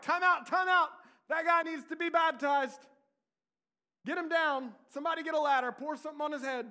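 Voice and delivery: high, loud mock panic calls; hammed-up crowd yelling to stop the crucifixion; mock frantic shouting. Preacher imagining onlookers shouting to baptize the criminal. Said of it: "They weren't like".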